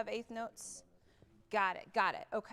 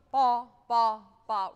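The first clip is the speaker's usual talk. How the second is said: each ba punched loud and detached; accented syllables; 15 dB louder, slower than usual. Shown as a demonstration. Conductor showing the band accented notes. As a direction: sing ba, ba, ba with a sharp accent on every note. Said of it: "and I went ba, ba, ba".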